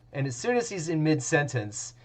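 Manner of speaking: normal talking voice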